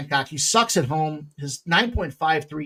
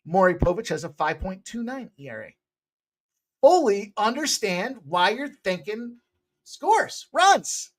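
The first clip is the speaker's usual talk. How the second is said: ordinary talk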